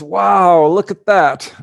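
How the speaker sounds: high, loud impressed wow; loud amazed look at that. Retold or imitated. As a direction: marvel at a shining flying saucer. Sister sees the UFO.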